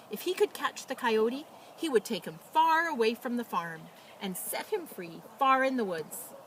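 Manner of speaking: lively, expressive storybook reading; animated picture-book narration; reading to children. A narrator's reading. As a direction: read it like bedtime story time for little kids. Reads picture book aloud.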